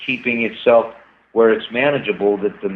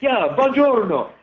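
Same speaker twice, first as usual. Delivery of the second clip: high, slow, hammed-up Italian buongiorno; mock Italian greeting; 9 semitones higher, slower than usual. Bees pictured greeting the beekeeper, hammed-up Italian accent. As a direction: sing out buongiorno like a jolly Italian. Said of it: "they're like Italian bees".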